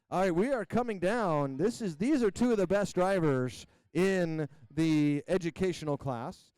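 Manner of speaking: ordinary talk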